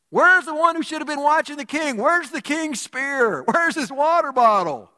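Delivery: high, loud hollered challenge; shouting across distance. Acted. David challenging the king's bodyguard from a far hill, shouting. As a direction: holler each question far across a valley.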